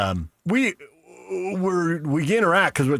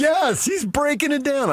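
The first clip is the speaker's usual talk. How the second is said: hyped; 13 semitones higher, slower than usual. Acted. Host hyping his own impression, high and slow. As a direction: cheer it like a fan.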